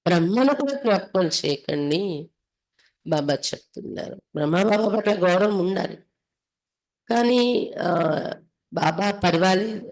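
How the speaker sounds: usual speaking voice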